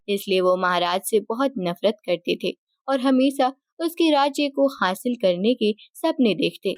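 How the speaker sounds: usual speaking voice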